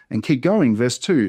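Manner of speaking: usual speaking voice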